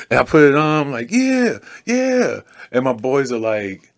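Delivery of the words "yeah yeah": high drawn-out cheer; excited cheering. Retold or imitated. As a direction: hype it up like a fan. Cheering after hat back on.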